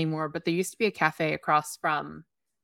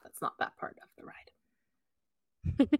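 whispered aside; breathy whispered afterthought; whispering; 9 dB quieter, much slower than usual. Real, not acted.

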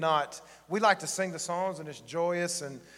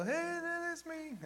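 Buddy the Elf; 5 dB quieter, much slower than usual. Retold or imitated. Elf excited in the department store, squealing high. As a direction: stretch it into a giddy high cry. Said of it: "he's getting real excited".

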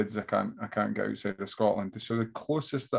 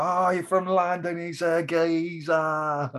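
high, drawn-out mock London accent; London geezer accent; 7 semitones higher, much slower than usual. Comic teasing a Londoner in the crowd, exaggerated London accent. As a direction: stretch it out as a mock Cockney geezer. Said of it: "oh, I'm from London".

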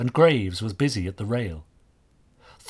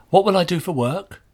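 put-on high, louder pleading question of a worried boy; plaintive; 8 semitones higher, 6 dB louder than usual. A narrator's reading. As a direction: ask it like a lost boy fearing for his job.

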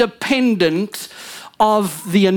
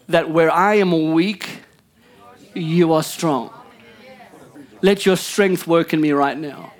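earnest prayer; 7 semitones lower than usual. Acted. Leads a prayer.

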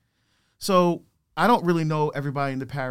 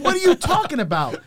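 baffled; 12 semitones higher, 5 dB louder than usual. Retold or imitated. Retold confusion with priest.

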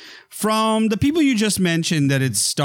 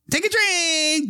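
sing-song high repeat; 14 semitones higher, slower than usual. Acted. Mock chant of the rule.

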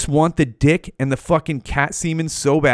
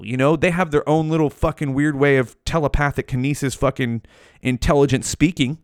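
normal talking voice

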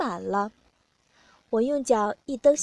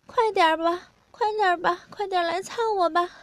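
higher-pitched voice of an eager partner; urgent pleading; 7 semitones higher than usual. A narrator's reading. Narrator voices the partner urging them on, urgent pleading.

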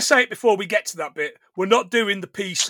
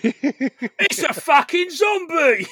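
slow, high mock shout through laughter; quoting Bricktop; 8 semitones higher, 3 dB louder, much slower than usual. Bricktop spotting a zombie, hammed-up laughing shout. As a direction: yell it like a panicked gangster, laughing. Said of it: "he's also got the best line".

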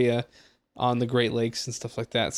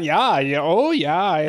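Minnesota yeah; 2 semitones higher, 4 dB louder, much slower than usual. Retold or imitated. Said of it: "They just say "yeah" a lot".

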